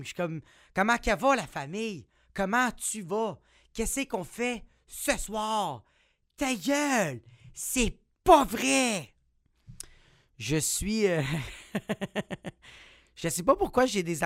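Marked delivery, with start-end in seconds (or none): 0.7-9.1 broad, drawn-out Québécois twang; Québécois accent. Acted. Catching up with Québécois buddies, broad Québécois accent. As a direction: exaggerate the Québécois vowels, rising to a high exclamation. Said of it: "plus avec mes chums, euh, québécois, je parle plus de même".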